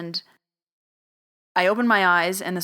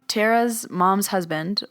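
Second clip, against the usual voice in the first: ordinary delivery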